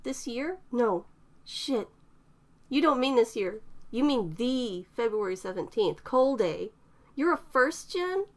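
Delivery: put-on a young woman catching on, rising alarm; shocked young woman. A narrator's reading. Eterna realizes, getting worked up.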